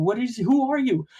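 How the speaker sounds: high, loud startled yelp of a question; shocked who are you. Retold or imitated. Retelling his startled reaction to his father's trophies, shocked. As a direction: blurt it out in pure shock. Retold shock at stranger in basement.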